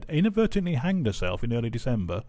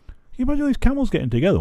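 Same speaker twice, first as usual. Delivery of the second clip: higher, louder, fast, giggling; laughing through the words; 13 semitones higher, 9 dB louder, much faster than usual. Real, not acted. Vlogger setting up the giraffe joke, laughing. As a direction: rush it out while laughing.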